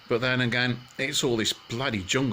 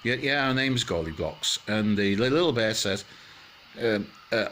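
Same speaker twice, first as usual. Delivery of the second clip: ordinary talk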